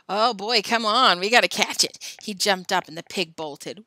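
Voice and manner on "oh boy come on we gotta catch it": loud, eager call; rally your friends to the chase; excited urging. Acted. Boy spots the loose pig, excited urging. Boys set off after pig.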